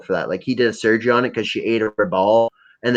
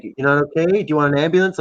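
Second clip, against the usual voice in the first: concerned friend; 5 semitones higher, faster than usual. Retold or imitated. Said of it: "He's like".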